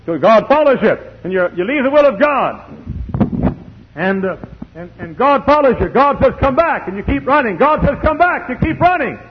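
high, driving pulpit delivery; high-pitched preacher's chant-like repetition; full-force preaching. Real, not acted.

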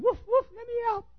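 very high little-dog voice begging; squeaky high dog voice pleading; talking Chihuahua. Acted. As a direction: whine to get out like a caged lapdog. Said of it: "He was saying".

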